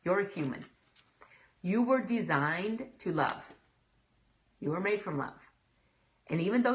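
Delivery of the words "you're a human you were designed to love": normal talking voice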